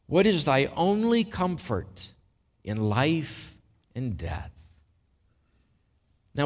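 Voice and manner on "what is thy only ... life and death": slow, deliberate reading. Real, not acted. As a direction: read slowly with weighty pauses. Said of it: "I'm gonna read this slowly".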